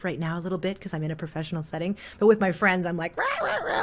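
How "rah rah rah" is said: heavy, high clowny rah-rah voice; thick clowny voice. Shown as a demonstration. Showing the voice she uses with friends, thick and clowny. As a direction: goof around in a thick clowny voice. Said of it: "sort of thick speaking voice".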